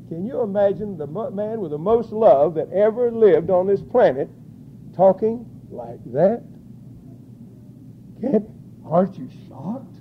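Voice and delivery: ordinary talk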